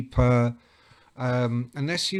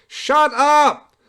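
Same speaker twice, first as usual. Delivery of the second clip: mock shout; 13 semitones higher, 10 dB louder than usual. Retold scene, loud exclamation.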